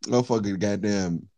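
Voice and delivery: usual speaking voice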